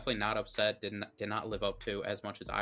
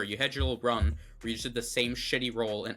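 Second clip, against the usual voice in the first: ordinary delivery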